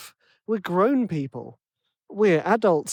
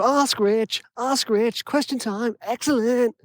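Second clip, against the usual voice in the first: higher, hammed-up American announcer accent; terrible American accent; 6 semitones higher, slower than usual. The host announcing question time in a bad American accent. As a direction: sell the American accent like a cheesy jingle. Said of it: "that's a terrible American accent".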